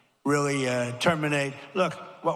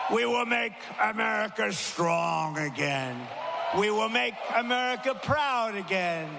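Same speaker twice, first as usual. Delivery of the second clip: high, sweeping closing oration building to shouts; rally finale; 6 semitones higher than usual. Real, not acted. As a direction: lift the crowd with a grand finish.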